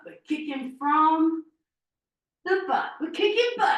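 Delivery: high, loud mock-American accent. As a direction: punch it out in a playful American accent. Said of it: "I said that in an American accent".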